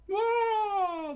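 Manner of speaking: very high, loud squeaky yelp; crew scream. Acted. Pirate crew startled by debris, high squeal. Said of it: "the pirate crew screamed out".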